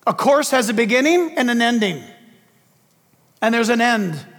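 ordinary talk, nothing marked

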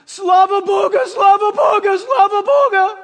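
loud, high, weeping cry of Slava Boga; shouting Slava Boga through tears; tearful shouted praise. Retold or imitated. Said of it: "weeping and crying".

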